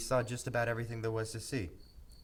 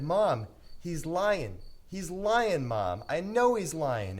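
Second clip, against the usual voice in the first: put-on louder, higher, slow childish pleading; insistent kid cry; 6 semitones higher, 6 dB louder, slower than usual. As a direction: plead like an upset little boy.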